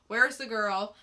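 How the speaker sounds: ordinary talk, nothing marked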